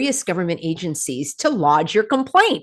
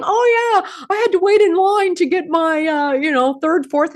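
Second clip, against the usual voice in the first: high-pitched mimicry of casual chatter; mock matter-of-fact; 11 semitones higher, 4 dB louder than usual. Host mimics people casually admitting they queued, in high mock-chatty voice. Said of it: "just in a matter of fact conversation saying".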